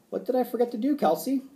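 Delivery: high, lifted questioning voice; teacher's prompting question. A narrator's reading. Teacher quizzing her pupil, high and questioning. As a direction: raise the voice to quiz a pupil.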